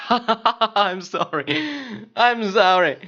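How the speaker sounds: high-pitched apology after a burst of laughter; laughing apology. Real, not acted. Player apologizes to viewers for screaming, laughing.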